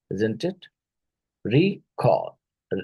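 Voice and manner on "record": "Record" with a raised first syllable, falling away; "Record" as REE-cord, pitched up; stress on first syllable. Shown as a demonstration. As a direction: hit the start of "Record", higher, then drop. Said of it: "First syllable".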